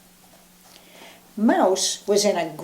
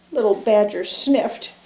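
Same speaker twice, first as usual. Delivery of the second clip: ordinary delivery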